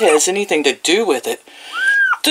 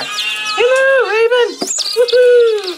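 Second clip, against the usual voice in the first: very high cooing; much slower than usual. Real, not acted. Cooing at a chick named Raven.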